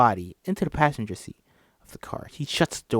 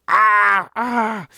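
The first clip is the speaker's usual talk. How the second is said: startled yelp; 9 semitones higher, 8 dB louder than usual. Acted. Chris attacked while driving.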